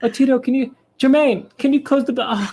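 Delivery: high-pitched put-on pop star voice. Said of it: "he's mixing and he's like".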